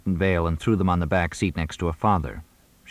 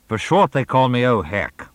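louder theatrical declaration; grand announcement; 5 semitones higher, 4 dB louder than usual. A narrator's reading.

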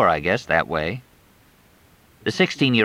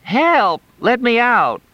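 high, louder hammed-up panic; mock cry for help; 5 dB louder, slower than usual. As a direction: play at being kidnapped. Mock cry from bumpy van.

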